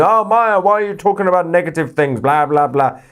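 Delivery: high, fast whiny mimicry; mock the ranting viewer. Mimicking a ranting viewer to the live chat, high and whiny. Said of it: "That idiot who was ranting earlier saying".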